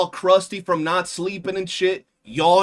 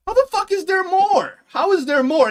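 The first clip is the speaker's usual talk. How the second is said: much higher, louder outburst of disbelief; exasperated disbelief; 8 dB louder than usual. Retold or imitated. Streamer reenacting his shock that there's more, high and loud. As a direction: throw it out like you can't believe it.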